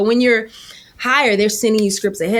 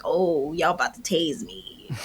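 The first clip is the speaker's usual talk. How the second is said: quiet realization; 5 semitones lower, 9 dB quieter than usual. Retold or imitated. Realizing she'll be cut.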